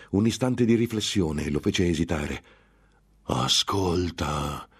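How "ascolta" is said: long, drawn-out tempter's call; demone tentatore che vocia. Acted. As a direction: stretch the word out slowly as the tempter. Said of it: "vociò il tentatore". (richiamo lento del tentatore)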